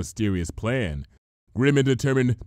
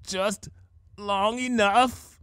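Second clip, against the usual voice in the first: slow, tight-throated strain; straining with effort; 7 semitones higher, much slower than usual. A narrator's reading. Rainbow Dash straining fast, words through tight teeth. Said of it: "teeth tight with effort".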